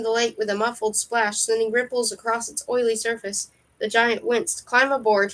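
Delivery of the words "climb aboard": ordinary delivery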